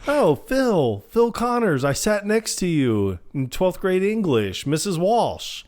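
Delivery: high-pitched, eager imitation; mock-chummy and insistent; eager reintroduction. Imitates Phil reminding old classmate.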